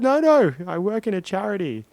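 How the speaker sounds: high, loud pretend pleading; hammed-up alarm, easing at the end; mock panicked plea. Swimmer pleading with attacking crocodile, high mock panic.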